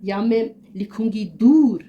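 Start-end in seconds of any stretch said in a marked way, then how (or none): 1.4-1.8 दूर with a long open oo, falling; teacher reading दूर with a clear oo; waw maroof demo. Shown as a demonstration. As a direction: stretch the oo of दूर. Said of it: "वाव मारूफ वो वाव है जो".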